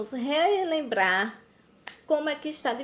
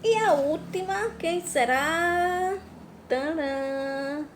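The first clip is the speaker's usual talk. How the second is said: playful suspense; 6 semitones higher, slower than usual. Acted. Building suspense for pupils.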